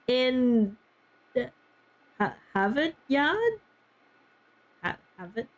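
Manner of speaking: halting, slow Boston accent attempt; unsure Boston accent, dropping the r's. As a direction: feel your way through a Boston accent. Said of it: "I do like the Boston accent".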